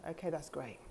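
very soft, low reassurance; parenting herself gently. Acted. Speaker parents herself through a sad evening, softly reassuring. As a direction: accept it quietly and kindly. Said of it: "I parent myself".

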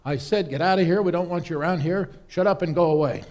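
loud exasperated shout; angry innkeeper. Acted. Innkeeper shouting at the persistent traveller, loud and exasperated. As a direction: drive him off for good.

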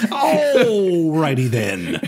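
slow, stretched, laughing Ace Ventura drawl; Jim Carrey catchphrase. Retold or imitated. Answering the Jim Carrey remark with a slow, laughing catchphrase. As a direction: drag it out like Jim Carrey mugging. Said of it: "There's a lot of Jim Carrey in that".

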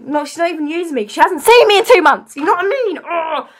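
high, loud burst of indignation; real frustrated outburst. Real, not acted. Girl venting that her best friend neglects her, frustrated. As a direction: vent it, rising into an exasperated groan.

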